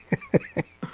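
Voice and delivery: short high giggle; a quick squeaky giggle; high squeaky laugh. Real, not acted. Laughs at forgotten name.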